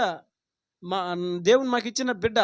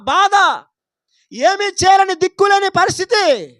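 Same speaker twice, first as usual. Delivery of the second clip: rising desperate cries, louder and higher; Hagar's despair; 7 semitones higher, 11 dB louder than usual. Acted. Preacher voicing Hagar alone in the desert, building to a desperate shout. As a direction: plead helplessly, building to a cry.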